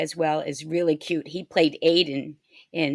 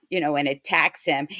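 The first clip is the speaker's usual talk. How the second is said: normal talking voice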